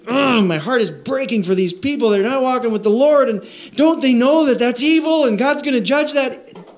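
high, distressed moral alarm; acted anguished dismay. Preacher voicing believers upset over sinners, acted anguish.

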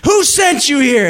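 mock-furious confrontation, each word stretched out; furious demand. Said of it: "she got mad and she turned around".

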